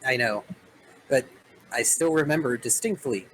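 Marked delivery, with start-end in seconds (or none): none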